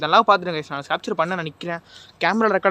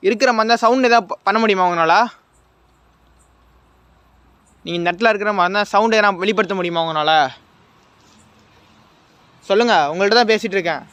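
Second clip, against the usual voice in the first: loud, insistent calls to an unseen presence; calling out; 4 dB louder than usual. Real, not acted. Ghost hunter urges the spirit to make a sound, loud. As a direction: raise your voice and demand an answer.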